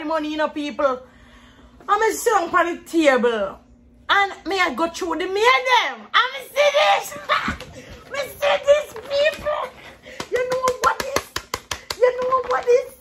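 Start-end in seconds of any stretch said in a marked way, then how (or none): 1.9-7.1 excited. Real, not acted. Excited reliving opening mail. 7.2-8.1 high squealing laughter; shrieking laugh. Real, not acted. 8.1-12.9 excited. Real, not acted. Excited reliving opening mail.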